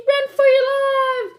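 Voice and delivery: high, loud panicked shriek. Acted. Chicken Little warning the birds, high shriek. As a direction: shriek the name in terror. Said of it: "she shrieked".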